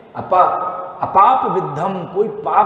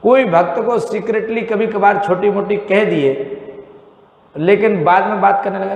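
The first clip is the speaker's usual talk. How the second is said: normal talking voice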